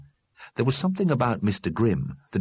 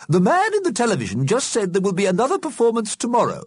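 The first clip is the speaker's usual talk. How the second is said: very high, louder character voice; prim Missus Liberty; 5 dB louder, faster than usual. A narrator's reading. Missus Liberty tells the dead about tomorrow, keen. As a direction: announce it with keen importance.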